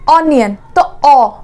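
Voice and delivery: ordinary delivery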